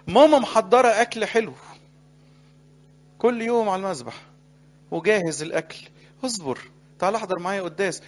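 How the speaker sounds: preaching at full force; rousing plea. Acted. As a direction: coax with growing force. Preacher pleads as the church.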